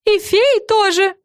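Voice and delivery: high, drawn-out cry; Френ crying out. A narrator's reading. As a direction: add it in a slow, squeaky call. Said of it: "прокричала Френ".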